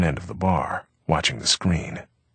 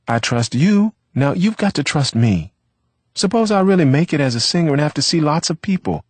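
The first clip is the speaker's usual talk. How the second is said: much higher and louder coaxing woman's voice; imagined girlfriend pleading; 9 semitones higher, 6 dB louder than usual. A narrator's reading. Girlfriend scolding Vivaldo's jealousy, pitched high and pleading. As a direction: coax him out of his jealousy, rising. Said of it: "She would say".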